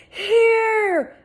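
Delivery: high loud shout for help; crying out. A narrator's reading. Girl hollering to rescuers on the beach. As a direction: holler so they hear you. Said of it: "I cried".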